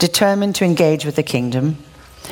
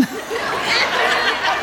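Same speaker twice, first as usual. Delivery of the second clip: a soft laugh at her own excuse; breathy laugh; 3 dB quieter than usual. Real, not acted. Preacher laughing at her own vanity, soft breathy laugh. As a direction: laugh quietly at yourself.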